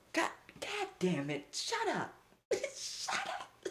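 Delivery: high squeal then breathy laughing shut up; real embarrassed laugh through the words; laughing embarrassment. Real, not acted. Streamer cringing at the story, laughing shut up.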